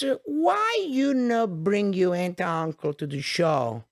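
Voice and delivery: put-on high, slow old-lady voice; elderly woman. Grandmother urging the comedian to bring his relatives, high and drawn out. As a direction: draw it out as a pleading old woman. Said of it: "She was, like, eighty-five years old".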